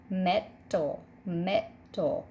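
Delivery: "metal" split as met, al; syllable break after consonant. Shown as a demonstration. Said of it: "syllable break is after the consonant".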